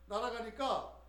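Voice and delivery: ordinary delivery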